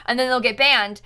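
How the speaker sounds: ordinary talk, nothing marked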